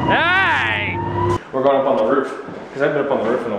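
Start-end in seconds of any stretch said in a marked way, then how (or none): 0.1-1.0 calling out. Real, not acted. Calling out on the street.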